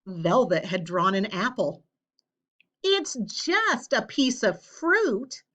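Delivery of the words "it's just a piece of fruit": high, slow, indignant protest. A narrator's reading. Sarah protests to the class about the winning apple, slow and high. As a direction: object as if the prize was stolen. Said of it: "Sarah protested".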